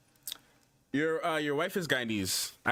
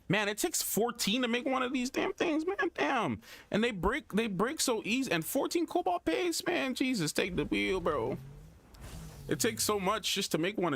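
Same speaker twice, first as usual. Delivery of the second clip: exasperated; 11 semitones higher than usual. Real, not acted. Complaining about crafting cost.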